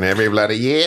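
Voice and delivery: put-on Aussie voice; playful Australian accent. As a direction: do a broad mock Australian "bloody year". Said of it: "I'm gonna do the voice".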